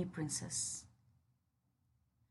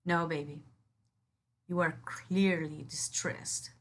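ordinary delivery

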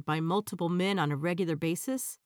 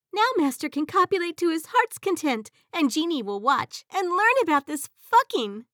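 high, loud proud proclamation; proud genie; 9 semitones higher, 7 dB louder than usual. Acted. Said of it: "I proudly proclaim".

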